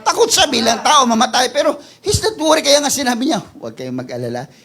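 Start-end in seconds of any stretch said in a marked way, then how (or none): 3.4-4.5 calm them gently as Jesus; reassuring Jesus. Acted.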